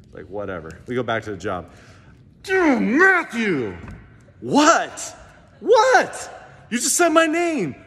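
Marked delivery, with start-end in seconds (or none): 2.4-3.8 stretched-out, very loud high call. Retold or imitated. Preacher hollering at a coworker to tease him. Yelling coworker's name again. 4.4-7.8 hammed-up, very high squealing coworker; mock coworker. Preacher mocking a coworker reacting to hearing his name.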